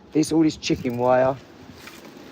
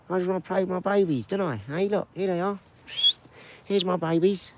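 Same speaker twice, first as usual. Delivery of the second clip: pet talk; 7 semitones higher than usual. Real, not acted. Talking to the dogs.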